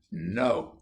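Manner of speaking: usual speaking voice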